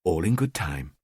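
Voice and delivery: low soft self-assurance; told to himself. Acted. Killer to himself, low soft murmur. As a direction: murmur it to yourself, calming down. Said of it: "I told myself".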